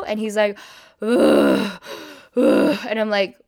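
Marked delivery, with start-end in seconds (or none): 1.0-2.8 imitated sick groan. Imitates drunk date groaning.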